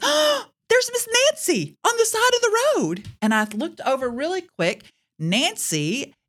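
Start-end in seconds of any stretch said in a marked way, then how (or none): 0.0-3.1 put-on high, excited girl's voice; a teenage daughter spotting someone; daughter's excited call. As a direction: call it out like a surprised teen.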